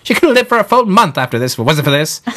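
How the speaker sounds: high-pitched scolding British wife; nagging wife voice. Acted. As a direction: channel a nagging wife from history. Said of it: "seances that we sometimes do where I channel history".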